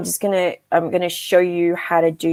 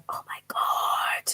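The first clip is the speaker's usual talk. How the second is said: breathy whisper to herself; whispered thought; 10 dB quieter, slower than usual. Retold or imitated. Retelling her own inner thought in a hushed whisper. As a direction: whisper it like a private panicked thought. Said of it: "And then I would have gone".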